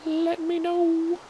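very high, slow sing-song. Pretend. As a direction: stretch it out, very high. Sudden sung high note.

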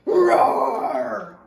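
one long, deep, loud roar; roaring bear. A narrator's reading. Bear at the girl's front door, big deep roar. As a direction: let out a huge low bear roar.

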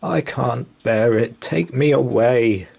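cried out. Acted. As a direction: plead to be taken away, building. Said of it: "he cried".

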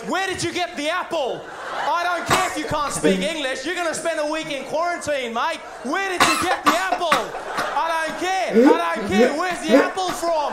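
high-pitched mock interrogation, building to a shout; irate customs officer. Customs officer interrogating traveller about an apple, mock-furious. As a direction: grill them with comic outrage, pitched well up.

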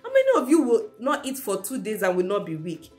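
ordinary talk, nothing marked

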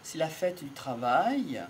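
ordinary talk, nothing marked

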